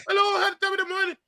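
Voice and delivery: very high, squeaky Trinidadian impression; high-pitched voice. Host teasing Trinidadians about their voices, high-pitched mimicry. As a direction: put on a comically high-pitched voice. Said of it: "So they got high pitched voices".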